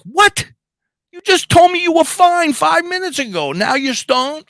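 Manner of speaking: husband can't believe his wife is suddenly stoned, high incredulous yell; shocked disbelief. Retold or imitated. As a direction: blurt it out in total disbelief.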